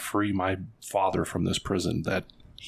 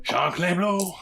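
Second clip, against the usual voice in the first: higher, hammed-up French name; bad French accent; 9 semitones higher, 3 dB louder, slower than usual. Player repeats the giant's name for laughs, in a bad French accent. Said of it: "my horrible French accent".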